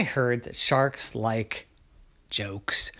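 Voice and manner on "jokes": ordinary delivery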